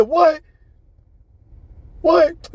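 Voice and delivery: high, loud incredulous yelp; shocked disbelief. Retold or imitated. Man stunned by an insult, shocked yelping. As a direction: imitate a stunned cry of "what".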